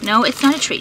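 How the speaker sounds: ordinary delivery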